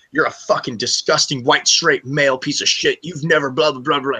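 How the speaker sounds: retold screaming. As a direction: imitate her screaming insults. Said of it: "starts screaming in my fucking face".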